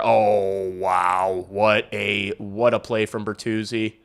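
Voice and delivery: usual speaking voice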